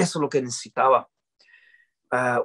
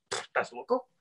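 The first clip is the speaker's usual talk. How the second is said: scoffing; 9 semitones higher, 9 dB quieter than usual. Real, not acted. Scoffing at the idea.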